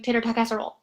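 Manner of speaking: tater tot casserole blurred fast into one word; run-on rush. Shown as a demonstration. Teacher mocking a rushed run-on word. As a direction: rattle it off with no gaps. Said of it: "all in one kind of run-on word".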